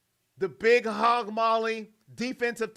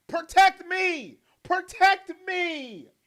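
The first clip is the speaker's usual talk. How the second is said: slow, loud, higher begging cry; mock pleading yell; 8 semitones higher, 6 dB louder, much slower than usual. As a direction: beg the line hammed-up. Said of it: "You know what Joe Flacco's thinking".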